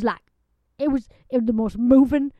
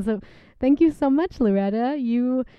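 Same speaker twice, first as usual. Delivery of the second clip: ordinary talk, nothing marked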